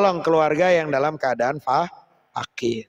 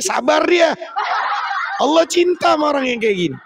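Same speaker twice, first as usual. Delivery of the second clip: laughing exclamation; 12 semitones higher than usual. Real, not acted. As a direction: burst out laughing at the joke. Laughing loud high punchline. (berseru sambil tertawa)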